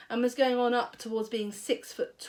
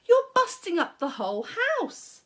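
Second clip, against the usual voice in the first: put-on higher boy protesting; upset boy; 9 semitones higher, 4 dB louder than usual. A narrator's reading. George protesting to Grandma. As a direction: cry out in dismay.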